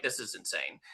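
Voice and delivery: quiet, low quoted reaction; retold muted disbelief. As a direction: imitate disbelief under your breath. Retelling their disbelief at police.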